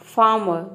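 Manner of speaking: फार्मर as "faa-muh", no r; silent r. Shown as a demonstration. Teacher listing examples for students, फार्मर without r. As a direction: say फार्मर with long aa and dropped r's. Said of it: "इसको आपको silent रख देना है".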